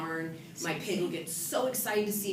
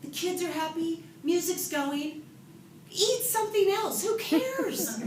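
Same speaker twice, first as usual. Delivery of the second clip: self-talk; 9 semitones higher than usual. Retold or imitated. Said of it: "and I said".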